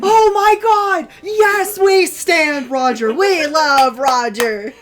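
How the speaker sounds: high, loud slow squealing; fangirl screaming. Real, not acted. Hearing Roger narrated, fangirl shrieking. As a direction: scream with pure fan joy.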